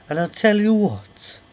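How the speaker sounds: high, loud, drawn-out Welsh lilt; Welsh accent. Acted. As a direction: put on a big slow Welsh accent. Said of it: "that was my Welsh accent".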